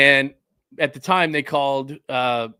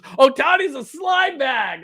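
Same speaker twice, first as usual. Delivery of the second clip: much higher, louder, slow hammed-up yell; mock shout; 12 semitones higher, 5 dB louder, slower than usual. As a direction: bellow the chat line like a rival fan. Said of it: "Dom says".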